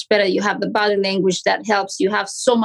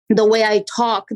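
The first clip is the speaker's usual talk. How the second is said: normal talking voice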